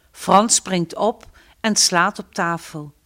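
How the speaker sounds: ordinary delivery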